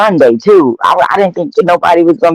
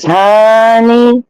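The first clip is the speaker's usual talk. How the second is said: drawn-out honey; 8 semitones higher than usual. Real, not acted. Drawn-out gossip opener.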